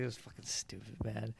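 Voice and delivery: quiet, low-key annoyed aside; retold annoyance. Friend recounting what happened in the movie, mildly annoyed. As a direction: imitate a grumble, kept soft and understated. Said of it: "he was all annoyed".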